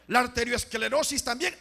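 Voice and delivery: emphatic preacher's call. Real, not acted. Each illness called, then repeated slowly.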